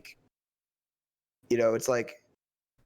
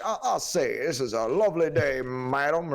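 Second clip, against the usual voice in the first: mock Southern gentleman. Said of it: "the Colonel".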